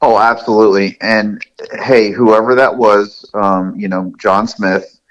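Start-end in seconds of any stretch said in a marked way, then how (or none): none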